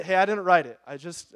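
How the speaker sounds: normal talking voice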